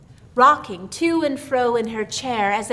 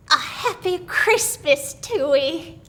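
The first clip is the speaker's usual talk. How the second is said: put-on a slow, higher old woman's greeting; lightly voiced old woman; old country aunt; 6 semitones higher, slower than usual. A narrator's reading.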